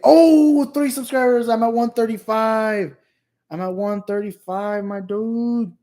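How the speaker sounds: high, drawn-out celebratory whoop; loud burst of delight, easing but staying high; excited cheer. Real, not acted. Streamer spotting new subscribers on the channel, excited.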